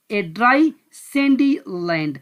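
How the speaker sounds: slow reading. Shown as a demonstration. Teacher reads answer slowly.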